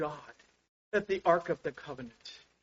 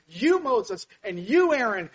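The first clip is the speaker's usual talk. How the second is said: angry crowd accusation; 6 semitones higher, 7 dB louder than usual. Retold or imitated.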